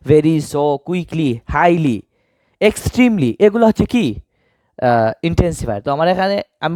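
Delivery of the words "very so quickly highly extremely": slow list. Shown as a demonstration. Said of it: "কোনগুলা".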